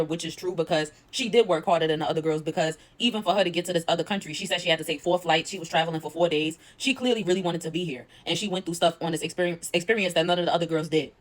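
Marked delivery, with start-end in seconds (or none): none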